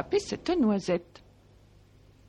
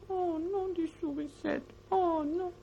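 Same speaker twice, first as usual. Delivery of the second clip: dismayed little mouse; 7 semitones higher, 4 dB quieter than usual. A narrator's reading.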